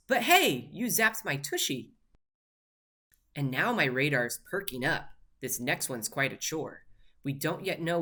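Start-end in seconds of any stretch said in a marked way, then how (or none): 0.1-1.9 loud high yelp of hey; sudden surprised hey; startled. Acted. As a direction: jolt as if zapped. Elf zapped by a trap.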